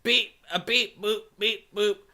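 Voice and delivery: deadpan robot comedian bit. Retold or imitated. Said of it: "deadpan robot comedian would sort of say".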